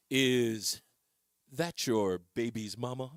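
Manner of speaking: low, sneering villain voice; evil doer voice. Shown as a demonstration. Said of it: "I say it like an evil, evil doer".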